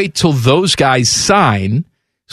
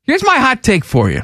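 mock announcement; 8 semitones higher than usual. Hot take announcement, mock drama.

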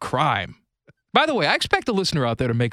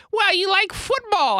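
put-on much higher old aunt reasoning; aunt's voice. Old aunt answering her nephew, much higher voice. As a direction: voice the old aunt, high and light. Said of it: "She says".

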